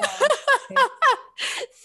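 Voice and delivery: high, bursting laugh; delighted laugh. Real, not acted. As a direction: laugh out loud, pleased. Laughing at the compliment.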